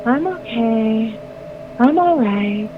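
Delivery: slow, drawn-out eerie imitation; not his voice. Wife imitating her convulsing husband, slow uncanny voice. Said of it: "but it was not his voice".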